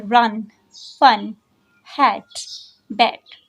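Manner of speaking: रन, फन, हैट, बैट each short and unbroken, spaced apart; quick single syllables. Shown as a demonstration. Teacher demonstrating one-syllable words, रन, फन, हैट, बैट each said quickly. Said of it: "quickly pronounce कर रहे हैं".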